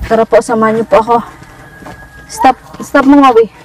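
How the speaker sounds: ordinary delivery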